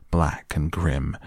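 usual speaking voice

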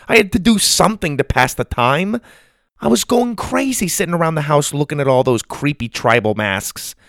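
high-pitched grandma justifying herself; defensive grandma. A narrator's reading. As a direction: plead your case in a bickering marriage.

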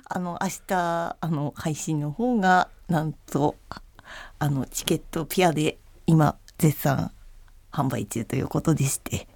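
soft, hesitant, whispery timid voice; timid person. Shown as a demonstration. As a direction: say it shrinking, barely daring. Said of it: "ちょっと気の弱い人で。".

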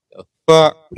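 sudden loud startled cry. Real, not acted. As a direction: jump at the noise. Startled by hitting the mic.

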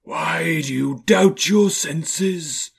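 high, loud, very slow spectral voice; eerie, drawn-out challenge; Marley's ghost. A narrator's reading. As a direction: stretch the question into a haunting wail.

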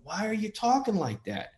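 high-pitched acted challenge; mock retort. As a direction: question him in a high mock voice. Acted retort to condescending man.

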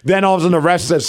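ordinary delivery

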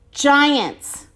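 ordinary delivery, nothing marked

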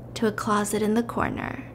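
ordinary delivery

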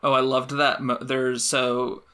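ordinary talk

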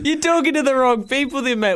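laughing through the words. Real, not acted. Host answering the caller's food jab, laughing. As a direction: talk while still laughing.

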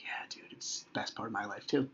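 very soft, near-whispered reply; tender dad. Retold or imitated. Dad answers son who loves visits, tender and hushed. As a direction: confide it softly to a child.

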